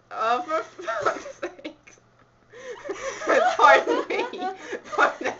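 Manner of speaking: high, loud laughter through the words; laughing. Real, not acted. Reader giving up on a failed accent, laughing. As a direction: swear and break down laughing.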